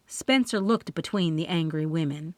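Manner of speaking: plain reading voice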